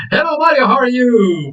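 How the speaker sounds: high, delighted hello; excited greeting. Real, not acted. Teacher welcoming a returning student, excited. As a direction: call out a high-pitched welcome.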